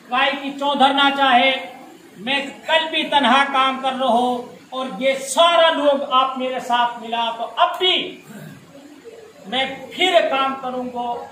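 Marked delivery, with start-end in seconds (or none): none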